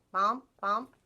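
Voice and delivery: usual speaking voice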